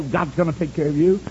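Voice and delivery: ordinary talk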